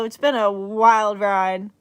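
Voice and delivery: usual speaking voice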